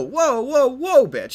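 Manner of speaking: high, loud "whoa" of alarm; shocked yelp. Acted. Naruto recoiling as Hinata corners him, high shocked yell. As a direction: yelp like you've been ambushed.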